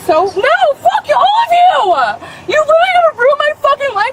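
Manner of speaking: shrill enraged yelling at officers; furious screaming. Real, not acted. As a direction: scream it in a rage. Screaming at police at traffic stop.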